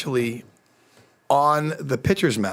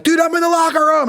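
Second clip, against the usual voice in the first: excited brag; 4 dB louder, faster than usual. Retold or imitated. Bragging call to friend.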